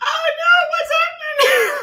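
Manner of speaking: high, loud, half-laughing panic; high panicked cry. Real, not acted. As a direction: cry out in giddy alarm.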